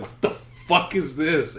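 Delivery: retold incredulous outburst. Coworkers reacting to the video, imitated incredulity. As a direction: stretch out the shocked question.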